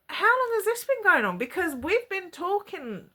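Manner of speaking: high, incredulous demand; upset confrontation, retold. Woman confronts online boyfriend about his girlfriend, high and upset. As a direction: push it high and stretch the complaint.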